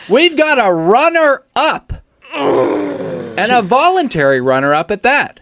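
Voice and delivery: hammed-up tearful proclamation; mock dramatic announcement. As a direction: announce it like a tragic game show reveal.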